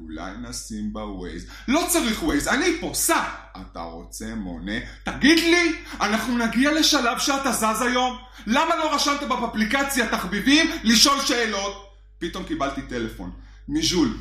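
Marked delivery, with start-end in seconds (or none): 0.0-1.5 quiet driver. Retold or imitated. Driver suggesting Waze. 1.7-3.2 impatient shout. Retold or imitated. Impatient passenger shouting at driver. 3.6-4.8 meek driver. Retold or imitated. Driver asks meekly. 5.0-11.9 exasperated rant. Retold or imitated. Exasperated at slow driver.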